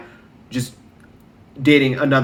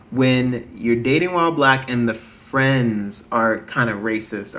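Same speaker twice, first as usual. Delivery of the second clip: ordinary talk, nothing marked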